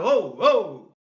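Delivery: loud mock "hold on"; retold startled objection. Retelling a comedian's reaction to a friend, loudly startled. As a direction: call out a halt, imitating alarm.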